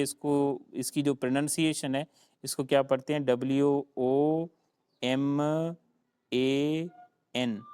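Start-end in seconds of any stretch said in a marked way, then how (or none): none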